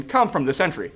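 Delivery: ordinary delivery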